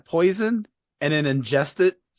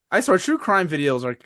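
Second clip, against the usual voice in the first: ordinary delivery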